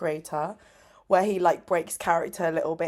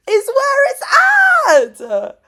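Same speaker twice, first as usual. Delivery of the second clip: much higher, very loud, slow, tearful cry-shout; 14 dB louder, much slower than usual. Real, not acted. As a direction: shout it again with tears breaking through. Repeats, now tearful.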